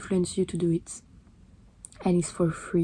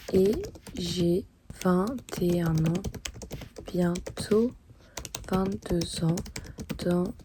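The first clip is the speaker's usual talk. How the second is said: slow, halting words with long pauses; dictating while writing; much slower than usual. Real, not acted. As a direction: say each phrase as you write it down. Composes letter aloud while writing it.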